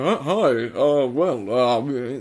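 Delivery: lightly mimicked stumbling hero; hesitant, rolling goofy delivery. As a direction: roll the voice a bit, fumbling for words. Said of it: "kind of rolling his, his voice too much".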